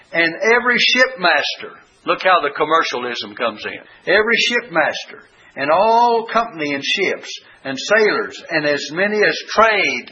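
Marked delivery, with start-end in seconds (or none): 0.1-1.8 "every shipmaster" lifted high and leaned on; emphasizing the words. Shown as a demonstration. Preacher stressing scripture words for his flock, lifted emphasis. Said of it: "I'm emphasizing the words". 4.1-10.1 each word leaned on, raised and slowed; emphasizing the words. Shown as a demonstration. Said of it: "I'm emphasizing the words".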